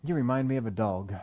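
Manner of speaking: low, soft, matter-of-fact put-down; deadpan mock insult. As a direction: drop it quietly, as if casually observing. Acted insult to someone raking leaves.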